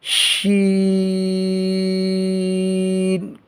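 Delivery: شین with the vowel held very long; شین recited with a long madd. Shown as a demonstration. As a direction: draw out شین slowly. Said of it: "مد بھی کرنی ہے".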